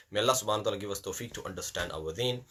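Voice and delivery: usual speaking voice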